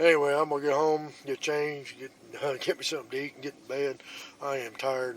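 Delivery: ordinary talk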